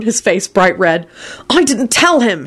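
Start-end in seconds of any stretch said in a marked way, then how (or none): 1.5-2.5 raised-pitch protest; furious shout. A narrator's reading. Harry defending himself to Arthur, mildly furious. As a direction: deny it hotly, without much volume. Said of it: "Harry shouted furiously".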